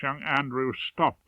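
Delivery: ordinary delivery, nothing marked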